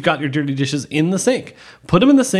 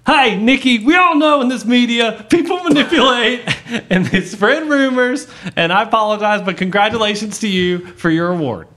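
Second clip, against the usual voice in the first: hammed-up Nashville twang, pitched way up; 7 semitones higher, 4 dB louder than usual. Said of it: "the sort of hick sense better".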